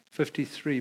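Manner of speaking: ordinary delivery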